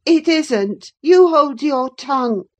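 put-on high, loud boy's rebuke; angry retort. A narrator's reading. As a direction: silence the nurse sharply, pitched high. Boy snaps back in quarrel.

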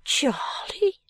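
high, breath-light questioning; astonished. Acted. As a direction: echo the name in disbelief. Astonished at Charley's news.

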